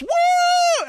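imitating a girl's gleeful whoop; excited whoop. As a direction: whoop with delight. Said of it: "She goes".

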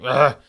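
loud, drawn-out groan of nausea; retold groan from being ill, loud and drawn out; sick groan. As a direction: groan like you're sick at three in the morning. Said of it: "audibly groaning".

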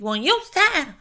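high, whiny mock protest; hammed-up nagging that trails into sing-song da-da; mock complaint to God. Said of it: "looking at God and saying".

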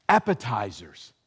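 ordinary talk

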